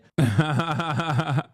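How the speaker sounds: amused laugh; short laugh. Real, not acted. Vlogger laughing at own joke, short laugh.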